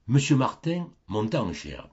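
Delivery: ordinary delivery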